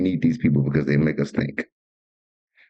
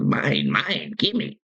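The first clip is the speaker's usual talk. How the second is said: put-on greedy toddler; 8 semitones higher, much slower than usual. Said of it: "That was his first words, was".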